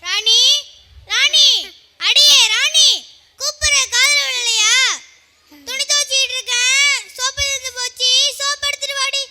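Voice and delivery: loud, impatient calling out; shouting. Acted. Mother calls her daughter to bring soap, shouting. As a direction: yell for someone who won't listen. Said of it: "இவ்ளோ கத்திக்கிட்டு இருக்க".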